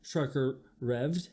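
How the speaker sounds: usual speaking voice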